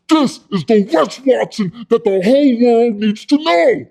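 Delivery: high, loud self-announcing yell; hammed-up pitchman shout; bombastic boast. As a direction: proclaim yourself to the whole world.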